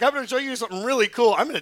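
God voiced with a fast, excited grin; playful God. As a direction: tease like you're hiding a surprise. God teasing Philip.

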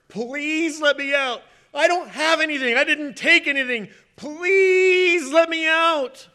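slow, loud, high begging; pleading robber. Retold or imitated. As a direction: drag it out like a robber begging on his knees. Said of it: "gets on his knees, pleading".

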